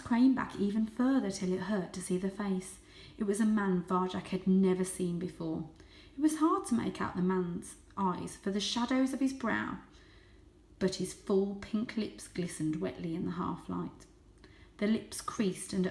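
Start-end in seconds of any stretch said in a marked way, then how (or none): none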